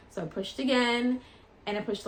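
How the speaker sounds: normal talking voice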